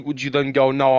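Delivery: usual speaking voice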